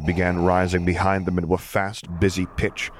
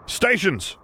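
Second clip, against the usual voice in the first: shouted order; 12 semitones higher than usual. A narrator's reading. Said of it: "Piet had to repeat about fifty times".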